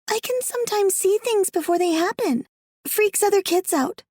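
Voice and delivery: put-on high child voice, a bit weary; little girl Alice. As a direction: speak as a young girl telling her story.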